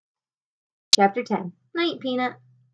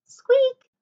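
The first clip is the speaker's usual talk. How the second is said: very high, loud mouse squeak; sudden high squeak; 5 dB louder than usual. A narrator's reading. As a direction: pipe up like a tiny mouse. The lost mouse is heard.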